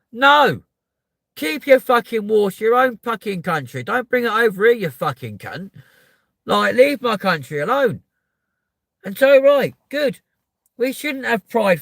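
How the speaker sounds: loud, high yelling rant; real anger, shouting; furious shout. Real, not acted. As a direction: roar it at him. Ranting at Putin.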